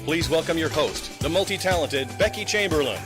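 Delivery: ordinary talk, nothing marked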